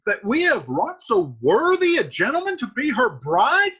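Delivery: indignant string of rhetorical questions; incredulous. Acted. Capulet astonished that his daughter refuses the match, incredulous. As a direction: punch key words, lift each question at its end.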